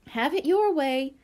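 ordinary delivery, nothing marked

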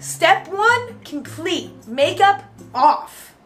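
hammed-up proud announcement; mock announcement. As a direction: announce it like a triumph. Announcing progress in vlog.

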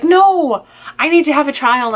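high-pitched indignant correction, easing off; emphatic protest. Retold or imitated. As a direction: cry out the no in mock alarm. Retold protest to the intercom remark.

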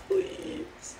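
high, squeaky little plea; squeaky please. Pretend. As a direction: beg in a tiny high voice.

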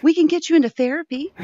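high fast cat-talk voice; high-pitched voice for the pets. Real, not acted. Talking to cats, high baby voice.

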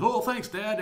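high, loud, hasty thanks; high-pitched, loud send-off; hurried thanks. Acted. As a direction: blurt it out high, eager to get away. Jacob hurrying off after stolen blessing.

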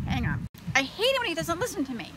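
ordinary delivery

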